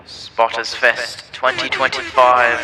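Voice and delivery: loud, slow megaphone announcement; through a megaphone. Acted. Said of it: "you got the megaphone".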